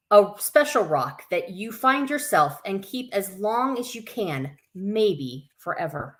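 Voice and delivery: put-on children's story reading. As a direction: read it out to little ones, bright and sing-song. Reads picture book to kids.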